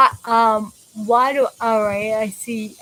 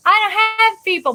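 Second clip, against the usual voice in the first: exclaiming; 8 semitones higher, much faster than usual. Real, not acted. Exasperated self-mocking exclamation.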